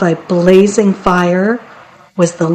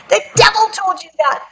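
mock angry scream; 12 semitones higher, faster than usual. A narrator's reading. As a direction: shriek it small, like a furious imp. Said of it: "he screamed".

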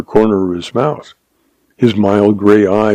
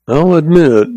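higher, mildly sheepish admission; meek; 6 semitones higher than usual. A narrator's reading. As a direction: concede it meekly, pitched up. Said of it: "the sheriff said meekly".

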